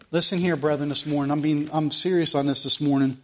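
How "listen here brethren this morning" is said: normal talking voice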